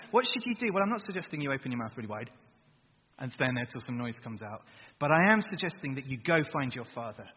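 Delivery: usual speaking voice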